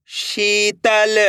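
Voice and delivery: शीतल very slowly, शी held long. Shown as a demonstration. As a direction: stretch the ee in शीतल. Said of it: "मेरे साथ साथ इनको repeat करना है".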